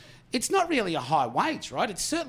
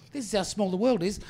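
ordinary delivery

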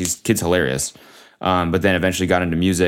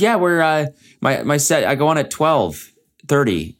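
casual; 7 semitones higher than usual. Retold or imitated. Voicing Rez's answer.

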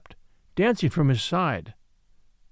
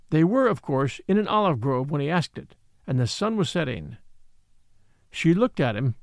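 usual speaking voice

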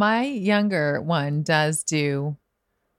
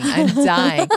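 dying, pitched up and louder; higher mock panic; 6 semitones higher, 4 dB louder than usual. Parent watching kid fail, acted panic.

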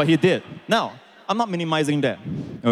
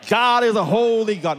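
solemn holy tone; 6 semitones higher than usual. Acted. Pastor repeating the objector's line, mock-solemn and high. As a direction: intone it like a mock-reverent objector. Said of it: "say it in that kind of tone".

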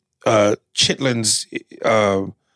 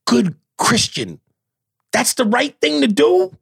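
incredulous; 13 semitones higher than usual. Retold or imitated. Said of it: "And I'm just going".

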